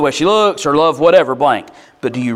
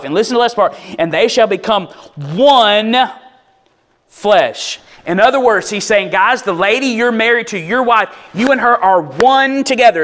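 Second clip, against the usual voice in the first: high, loud preaching build; full-force preaching; 9 semitones higher, 4 dB louder than usual. Real, not acted. Preacher driving home one flesh to the husbands, full force. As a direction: preach it hard and insistent.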